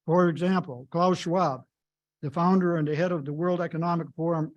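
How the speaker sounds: ordinary talk, nothing marked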